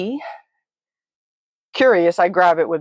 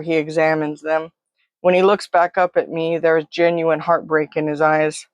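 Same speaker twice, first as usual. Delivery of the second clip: usual speaking voice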